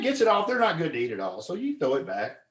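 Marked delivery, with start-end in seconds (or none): none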